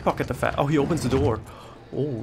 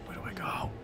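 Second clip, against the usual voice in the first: breathless whisper so he won't hear; frightened whisper; 13 dB quieter than usual. Real, not acted. Hiding from the angry man, scared whisper.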